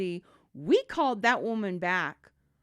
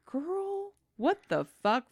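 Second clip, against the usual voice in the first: incredulous; 10 semitones higher, slower than usual. Retold or imitated. Narrator's own shocked reaction.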